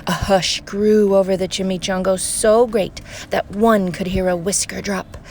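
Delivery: plain reading voice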